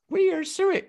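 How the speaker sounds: badly faked, very high cartoon voice; Mickey Mouse. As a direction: squeak it out like a failed cartoon impression. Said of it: "I can't even do the Disney, uh, the Mickey Mouse voice".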